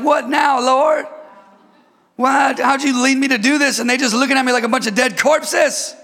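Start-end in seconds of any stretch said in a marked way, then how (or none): 0.0-3.8 exasperated cry. Retold or imitated. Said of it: "you're like".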